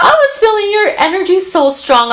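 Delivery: high, laughing through the words; real laugh, pitched high. Real, not acted. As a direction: laugh it off, a bit embarrassed. Laughs off getting emotional.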